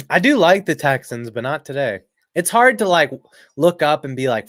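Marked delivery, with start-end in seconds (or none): none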